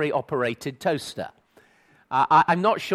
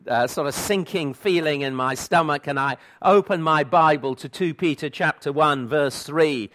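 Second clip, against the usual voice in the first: ordinary delivery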